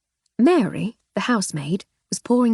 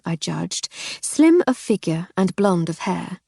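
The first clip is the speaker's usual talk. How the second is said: ordinary delivery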